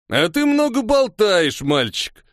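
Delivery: high, slow, drawn-out taunt; taunting bandit. A narrator's reading. Scarred bandit to the boy before charging, drawn-out taunt. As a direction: pitch it way up and stretch it mockingly.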